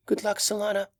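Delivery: put-on small frightened child; little girl voice.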